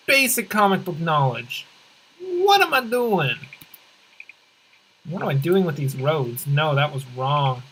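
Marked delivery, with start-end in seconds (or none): 2.2-3.4 high, drawn-out cry of dismay; stretched, high-pitched self-reproach; mock exasperated outburst. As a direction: wail it slowly at your own blunder. Mock exasperation at own blunder.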